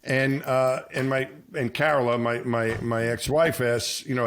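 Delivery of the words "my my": usual speaking voice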